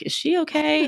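high-pitched mock concern; concerned onlooker. Runner imagining what racers thought, concerned onlooker voice. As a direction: imitate a worried bystander, pitched well up.

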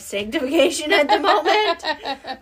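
real laugh bubbling up mid-sentence. Real, not acted. Friend admitting she's being refined by fire, laughing. Joking about own hard sanctification.

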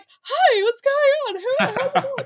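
very high, loud gushing hello; overexcited greeting. Retold or imitated. Plumber popping out to meet girl sparky, overexcited. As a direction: squeal hello like meeting a new best friend.

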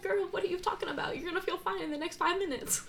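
a mock no-nonsense friend talking sense into her; scolding inner voice.

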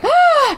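high, sharp gasp of shock; quick squeaky intake of fright; mock gasp. Imitating a woman seeing two Harts, mock gasp. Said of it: "And she's like".

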